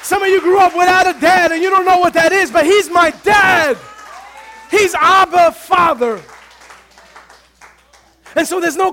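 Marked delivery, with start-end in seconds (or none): 2.5-6.2 drawn-out shouted preaching; slow, loud and deliberate, over the music; preaching it slowly at full force. Real, not acted. Preaching climax.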